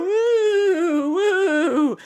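long falsetto woo; high cheering woo. Pretend. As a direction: celebrate with high, drawn-out woos. Celebrating the crowned Scream Queen.

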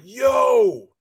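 loud, high, stretched-out yo; astonished shout. Retold or imitated. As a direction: retell a stunned yell. Retelling his astonished reaction.

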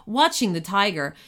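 ordinary delivery

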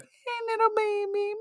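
put-on very high, soft, drawn-out baby talk; talking to cats. Shown as a demonstration. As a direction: coo at a cat in a tiny high voice. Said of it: "I talk to my cats like".

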